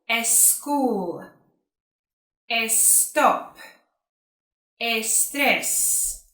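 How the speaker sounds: extra "e" sound before the s: "eschool", "estop", "estrés"; Spanish-speaker mispronunciation. Shown as a demonstration. Teacher imitating Spanish learners' error, "eschool" with an eh in front. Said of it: "put the letter E in front of the letter S".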